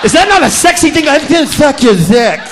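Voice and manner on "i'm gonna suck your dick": lightly lisping imitation of Mo; mocking Mo's lisp. Comic mocking the woman's lisp to the crowd, lisping. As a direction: mimic her slow lisping come-on, hammed up. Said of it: "You with that voice, that is so ridiculous. It's a lisp".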